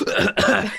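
choking cough after a swig; acted cough. Host showing how spirits hurt him, acted cough. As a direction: cough as if the drink burns. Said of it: "I go like".

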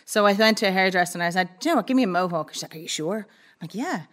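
normal talking voice

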